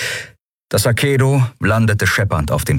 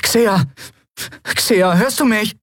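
put-on high, anxious boyish voice; urgent calling; slower than usual. A narrator's reading. Miko shaking his petrified friend, urgently calling. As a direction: try to get through to a frozen friend.

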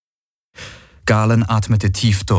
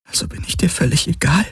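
hurt question; 8 semitones higher, faster than usual. Acted. Kirai asking if she means nothing to him, hurt. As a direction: ask it hurt and fast.

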